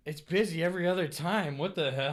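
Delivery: loud, high disbelief; baffled, loud what-the-hell; puzzled exclamation. Retold or imitated. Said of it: "I'm like".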